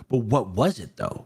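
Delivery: normal talking voice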